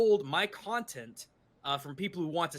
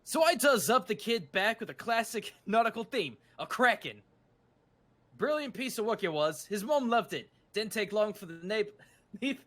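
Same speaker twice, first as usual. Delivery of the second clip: lively, higher mock-British voice; pseudo-British accent; 4 semitones higher than usual. Tattooist boasting about a kid's nautical back piece, pseudo-British accent. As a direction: put on an exaggerated British accent. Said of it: "with the pseudo-British accent".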